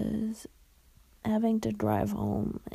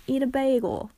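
hammed-up New York accent; loud, badly faked New York accent; 6 dB louder than usual. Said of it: "That was terrible".

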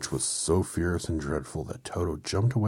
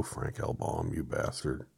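plain reading voice

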